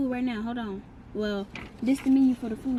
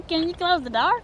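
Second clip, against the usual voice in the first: mimicking child; 6 semitones higher, 7 dB louder than usual. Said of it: "I think she meant open".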